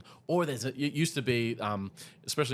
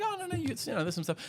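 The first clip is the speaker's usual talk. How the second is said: high-pitched self-mocking deflection; awkward modesty; 14 semitones higher, faster than usual. Cartoonist playing down their work to a stranger, awkward and high. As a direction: wave off the praise, pitched high.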